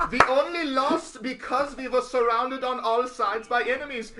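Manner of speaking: high, loud hammed-up German whine; mock-indignant excuse in a German accent. Friend imitates neo-Nazis making excuses, loud mock-German accent. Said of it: "neo-Nazis".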